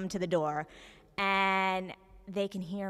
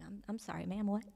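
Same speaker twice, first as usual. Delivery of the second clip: baffled whisper; 7 dB quieter than usual. Retold or imitated. Caught off guard by angry caller.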